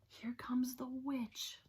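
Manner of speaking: slow, soft whisper. Acted. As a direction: whisper it fearfully. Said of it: "they whispered".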